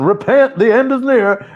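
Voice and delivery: high, loud street-corner preacher cry. Retold or imitated.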